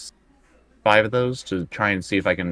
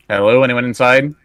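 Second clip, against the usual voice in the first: raised, calling into an empty cabin; 5 semitones higher, 8 dB louder than usual. Pretend. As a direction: call out loud to whoever might be inside.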